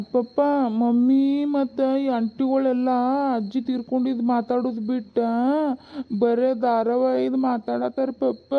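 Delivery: high, put-on child voice; child tattling. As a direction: whine to papa like a tattling child. Child tells on mother to papa. (ಅಪ್ಪನಿಗೆ ದೂರು ಹೇಳುವ ಮಗುವಿನ ಎತ್ತರದ ದನಿ)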